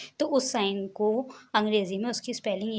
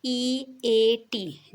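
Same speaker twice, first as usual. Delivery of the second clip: slow spelled letters; 3 dB louder, much slower than usual. Shown as a demonstration. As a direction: spell each letter very slowly. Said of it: "अंग्रेज़ी देखिए इसकी". (अक्षर-अक्षर धीरे-धीरे बोलें)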